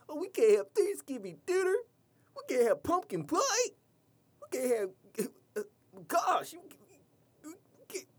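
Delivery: very high, loud whiny mock voice; shrill falsetto parody of holiday complainers; mocking whiners. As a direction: whine like a spoiled kid about dinner. Mocking whiners about Thanksgiving.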